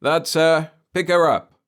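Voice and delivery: gang leader's order. Acted. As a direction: bark the order at the thugs. Henchman leader giving order.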